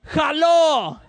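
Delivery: high, loud angry greeting; imitated yelling mom. Immigrant mom out of English at a crash, furious mock yell. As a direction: yell the greeting as if it were a curse. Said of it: "starts yelling at this dude".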